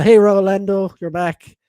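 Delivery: usual speaking voice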